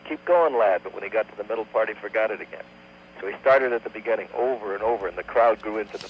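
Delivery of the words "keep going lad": higher-pitched cheer, only lightly raised; mild encouraging shout. Acted. Storyteller as crowd urging on the saxophonist, mild encouraging shout. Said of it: "they kept shouting encouragement".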